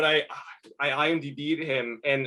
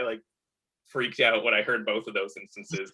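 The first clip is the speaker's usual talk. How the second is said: ordinary talk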